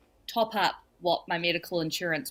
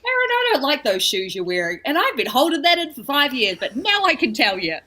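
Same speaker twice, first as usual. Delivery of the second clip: gleeful outburst; 6 semitones higher, 5 dB louder than usual. Retold or imitated. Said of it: "I was like".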